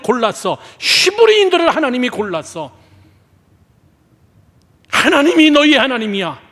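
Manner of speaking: high, forceful rebuking address; impassioned preaching. Acted. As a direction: drive each statement home loudly and high.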